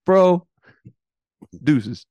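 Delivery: dude voice, hip and casual; cool dog voice. Retold or imitated. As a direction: throw it off like a laid-back dog. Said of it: "The dog said".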